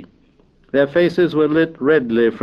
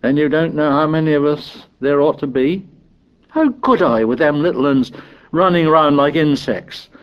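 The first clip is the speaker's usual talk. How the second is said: put-on aggrieved boy, high and protesting; exasperated high-pitched excuses; Piggy; 6 semitones higher than usual. A narrator's reading. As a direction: defend yourself indignantly, pitched up. Piggy defends himself to Ralph.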